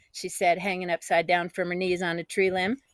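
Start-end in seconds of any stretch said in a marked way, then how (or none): none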